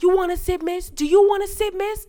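high, loud mock pestering; imitating loud man. Man repeatedly offering his seat to a passenger, loud mock pestering. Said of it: "there was this loud-ass man".

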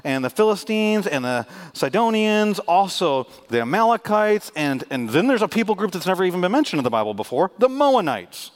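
loud, high preacher's list; proclaiming the enemies from the pulpit; preaching. Acted. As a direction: build the list like a sermon climax. Preaching list at full force.

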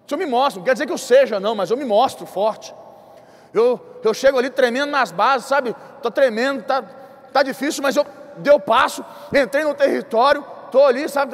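usual speaking voice

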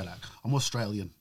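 low, quiet attempt at Australian; bad Australian accent. Shown as a demonstration. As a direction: try Australian from the belly, badly. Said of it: "That's a shit accent".

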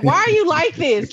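the son groaning at his mom's crude joke; exasperated son. Retold or imitated. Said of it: "And he's like".

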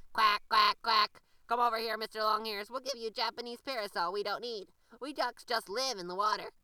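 loud, quacking duck lady calling out. A narrator's reading. The duck lady calls the rabbit over, loud and quacking. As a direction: call him over like a bossy duck.

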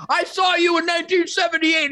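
high-pitched imitation of a thrilled fan; mock starstruck holler; excited fan shout. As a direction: call out like an overexcited fan. Acts excited fan greeting him.

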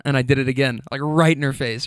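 ordinary talk

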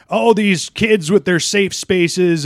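high, slow mocking sneer. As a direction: sneer it like a smug pundit. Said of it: "the people who are usually pushing the".

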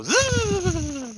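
long high buzzing zzz; imitated rope zip. Telling how the rope starts to go, a drawn-out zipping noise. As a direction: imitate a rope whizzing away.

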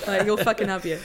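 sidekick bravado. Retold or imitated. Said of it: "that's me, just behind".

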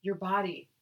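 usual speaking voice

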